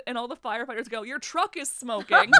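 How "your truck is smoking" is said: slow, louder call across the road. Retold or imitated. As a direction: shout a warning to the passing driver. Firefighters calling to the driver.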